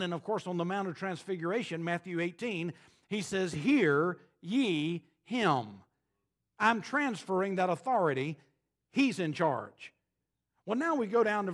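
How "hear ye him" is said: voice of God. Retold or imitated. God's voice quoted from heaven.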